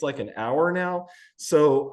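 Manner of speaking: ordinary delivery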